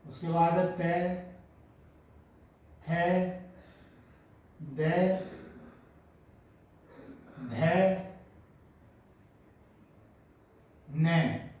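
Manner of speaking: टे, ठे, डे, ढे, अण spaced out; slow dictation. Shown as a demonstration. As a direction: dictate the row slowly. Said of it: "तो पहले ये लिख लेते हैं".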